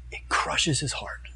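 slow, near-whispered heartbreak; hushed sorrow. Real, not acted. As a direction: drop to a slow, breath-only hush. Preacher stresses Jesus's heartbreak.

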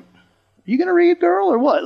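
high-pitched, drawn-out prodding; impatient classmate. Retold or imitated. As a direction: mimic kids pestering a slow reader. Classmates impatiently prodding silent girl.

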